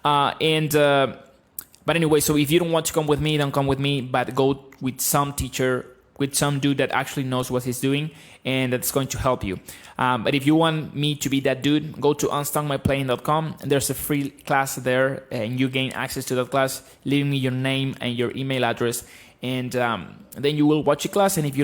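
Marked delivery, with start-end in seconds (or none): none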